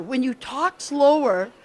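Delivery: slow, measured delivery. Shown as a demonstration. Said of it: "just by slowing down your speech".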